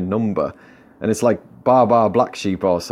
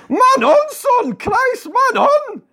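high, loud warning yell; shouting; 4 dB louder, much slower than usual. Acted. Martin warns a panto actor as if at football, high shout. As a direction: yell it like a frantic football fan. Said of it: "But Martin shouts".

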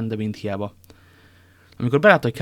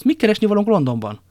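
high, loud protesting question; astonished exclamation; 10 semitones higher, 7 dB louder than usual. A narrator's reading. Porthos questions the trip to London, exclaiming in astonishment. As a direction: exclaim in baffled disbelief. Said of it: "kiáltott fel Porthos".

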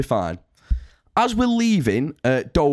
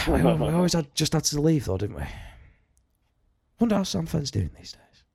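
wistful, sighing and trailing off softly; a little emotional; 4 dB quieter than usual. Pretend. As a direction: drift into a hushed, nostalgic reminiscence. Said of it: "A little bit emotional there".

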